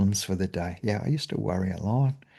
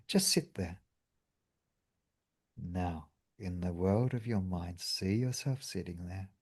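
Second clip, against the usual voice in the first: guided meditation; 8 dB quieter than usual. Acted. Leads a meditation.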